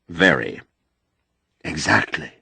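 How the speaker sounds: usual speaking voice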